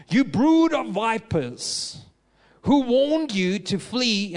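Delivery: a prophet scorning the hypocrites by the river; mocking rebuke. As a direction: thunder it down at the Pharisees slowly. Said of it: "He's basically mocking them".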